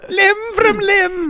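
very high, slow mock Irish echo; exaggerated high Irish falsetto; bad Irish accent. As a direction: stretch it out in squeaky mock Irish. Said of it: "That's a bad Irish accent".

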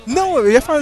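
ordinary talk, nothing marked

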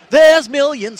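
high, loud cartoon giraffe; chirpy toy-shop mascot shout. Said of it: "God that I knew were called Geoffrey".

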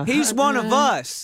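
high, slow mock-approving drawl; hammed-up high Southern voter; gullible Southern voter. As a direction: gush like a won-over country voter. Mock voters taken in by Southern act.

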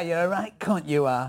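sweary Northern English accent; British accent. Shown as a demonstration. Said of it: "when you, uh, talk British".

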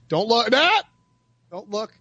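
sharp don't look; urgent call. Real, not acted. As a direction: snap out a warning. Stopping a glance.

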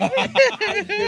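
high burst of real laughter. Real, not acted. Host laughs after admitting he was joking, high laughter.

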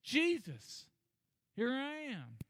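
high crying out to Jesus; mock scream. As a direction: raise the pitch, calling for attention. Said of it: "basically screaming".

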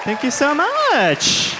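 gushing thanks. Real, not acted. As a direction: stretch out an over-the-top thank you. Thanking applauding audience.

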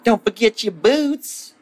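very high put-on woman's voice; hammed-up high-pitched reminder; nagging wife voice. Emma reminds Stan as he leaves, shrieky wife voice.

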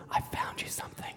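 dad's secretive, hushed whisper. Retold or imitated. Dad back from the trees to his child, whispering. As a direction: whisper it very softly. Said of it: "he whispers".

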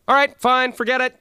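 exasperated. Acted. Host gives up on the Canadian, loud exasperated outburst. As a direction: throw up your hands and yell.

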